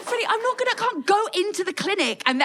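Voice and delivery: high, exasperated protest through laughter. Retold or imitated. Telling her friend Freddie off, high exasperated protest. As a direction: push back high and incredulous.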